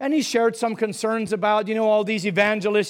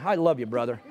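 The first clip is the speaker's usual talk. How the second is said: mock lukewarm greeting, lower, with a laugh; cold-shoulder greeting; 7 semitones lower, 4 dB quieter than usual. Churchgoer brushing off a fellow member, mock cold. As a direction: toss off "I love you, brother" coldly, half-laughing. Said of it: "one of those cold shoulder".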